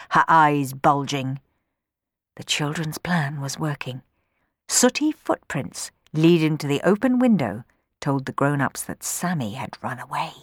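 usual speaking voice

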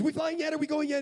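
put-on high, loud eager kid; impatient little boy. Boy waiting on the runway, impatient kid. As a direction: pester like an excited child. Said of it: "there's this little boy".

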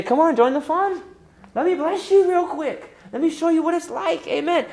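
high, loud, eager offer to share; generous churchgoer. Pretend. Churchgoer urging a sister in need, high loud and eager. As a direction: call her in like you're handing out joy. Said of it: "and you say".

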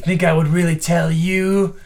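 defiant goblin. Acted. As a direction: throw it back at them with contempt. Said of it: "And he says".

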